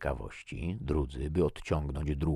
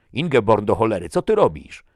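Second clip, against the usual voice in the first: loud, high scolding snarl; captain's loud, irate rebuke; angry bark; 7 semitones higher, 14 dB louder than usual. A narrator's reading. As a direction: snap at a subordinate caught misbehaving. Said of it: "warknął kapitan".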